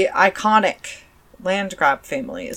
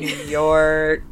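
put-on New York accent; 3 semitones lower than usual. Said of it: "that's a New Yorker accent".

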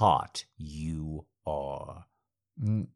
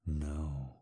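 normal talking voice

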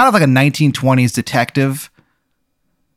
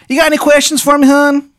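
extremely high, louder Maryland caricature; bad Maryland accent; 4 dB louder than usual. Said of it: "It's a bad accent".

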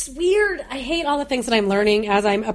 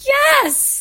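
excited yes; 13 semitones higher, 4 dB louder than usual. Retold or imitated. Friend relieved at being heard.

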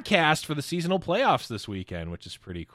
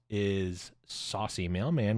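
ordinary talk, nothing marked

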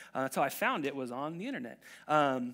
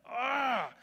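a raised, fed-up groan; disgusted ugh; 7 semitones higher than usual. Retold or imitated. The angry boy groaning in disgust. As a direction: groan at the whole world. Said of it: "He's just like".

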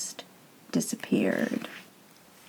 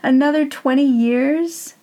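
louder, higher, incredulous rising question; scoffing disbelief; 7 semitones higher, 5 dB louder than usual. Acted. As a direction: throw the number back with a laugh in it. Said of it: "Mister Death laughed".

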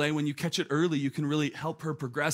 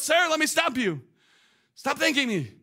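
higher, firm interruption; emphatic agency woman; 8 semitones higher, 4 dB louder than usual. Retold or imitated. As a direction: cut him off kindly but firmly. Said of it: "And she goes".

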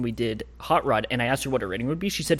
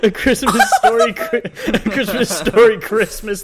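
high, loud, laughing; 7 semitones higher, 9 dB louder than usual. Real, not acted. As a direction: crack up saying the title. Laughing at the title.

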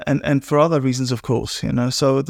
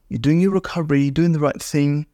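ordinary delivery

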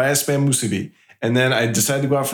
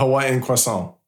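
Hawaiian-French croissant. Shown as a demonstration. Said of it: "[name] steals accents".

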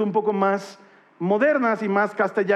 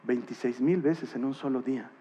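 awed hush; 8 semitones lower, 7 dB quieter than usual. Real, not acted. Preacher recounting his own revelation, hushed and awed. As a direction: whisper it in wonder.

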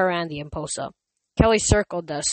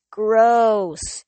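disgusted; 4 semitones higher, 6 dB louder than usual. Acted. Rachel covered in monster dust.

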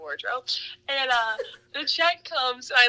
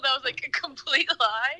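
ordinary talk, nothing marked

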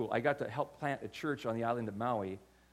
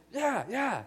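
higher, keen yeah-yeah; playing his younger self, overeager; a hint of a cocky young surfer; eager young self; 9 semitones higher than usual. Eager reply to the offered ride.

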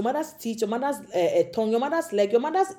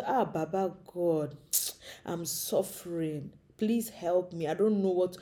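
soft, slow pleading to God; humble pleading prayer; 3 semitones lower, 6 dB quieter, slower than usual. Retold or imitated. Migrant on knees pleading to God, soft and slow. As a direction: beg God quietly and slowly, humbled. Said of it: "go on your knees and say".

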